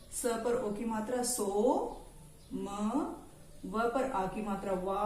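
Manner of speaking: ordinary delivery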